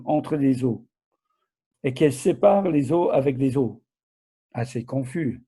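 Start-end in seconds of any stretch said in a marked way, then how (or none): none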